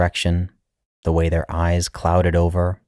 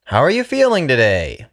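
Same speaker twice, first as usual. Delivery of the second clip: much higher, louder chipper doctor; bright bedside question, pitched up; young doctor; 8 semitones higher, 4 dB louder than usual. Acted.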